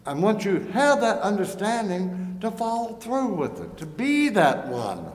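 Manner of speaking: preaching at full force. Acted. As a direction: build it up like a pulpit appeal.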